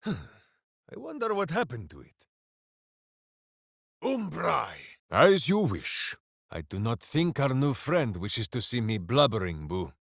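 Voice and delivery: normal talking voice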